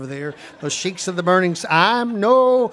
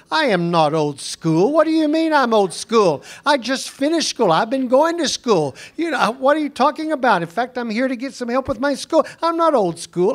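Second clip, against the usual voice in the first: raised, indignant retort, higher pitched; indignant protest; 6 semitones higher than usual. Retold or imitated. Egyptian guide insists to the preacher he is not old school, indignantly. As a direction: protest hotly, offended at being called old school.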